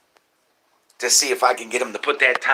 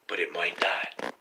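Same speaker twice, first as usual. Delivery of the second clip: whispered, teasing doubt; a sly stage whisper; 4 semitones lower, 6 dB quieter than usual. Real, not acted. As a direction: drop to a whisper to tease. Whispered aside on the goal.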